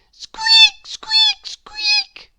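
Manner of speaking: very high, urgent little squeaks; mouse squeaks. A narrator's reading. Mouse squeaking to wake the sleeping man. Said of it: "stood by the side of the bed and squeaked".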